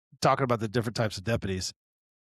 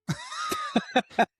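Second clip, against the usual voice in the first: high burst of laughter. Real, not acted. Deputy laughing at the lieutenant story, real laugh.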